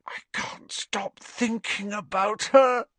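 high, hoarse whispery confession. A narrator's reading. Ron confesses lovesickness to Harry, hoarsely. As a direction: force it out high and half-voiced. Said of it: "said Ron hoarsely".

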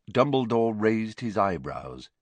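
ordinary delivery, nothing marked

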